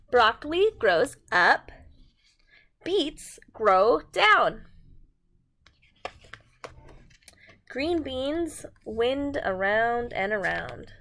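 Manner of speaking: slow, emphatic read-aloud with big sing-song swings; animated storytime voice; reading to children. A narrator's reading. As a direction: read it out like a picture book for little ones. Reading picture book to children.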